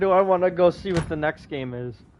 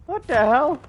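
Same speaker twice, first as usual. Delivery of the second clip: surprised; 9 semitones higher than usual. Real, not acted. Reacting to the stranger.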